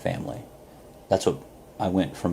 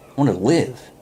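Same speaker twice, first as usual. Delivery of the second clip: higher, louder retold cry of desperation; desperate outburst; 5 semitones higher, 5 dB louder than usual. Nearly drowned young man answers the guru, acted desperate outburst. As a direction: burst out as the man just pulled from the water.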